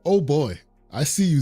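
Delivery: normal talking voice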